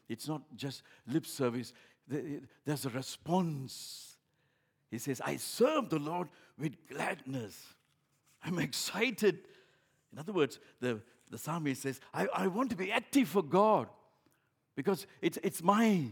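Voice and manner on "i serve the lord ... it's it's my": loud, higher, excited proclaiming; fervent preaching. Acted. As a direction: proclaim the psalm with fire. Quoting psalmist with fervour.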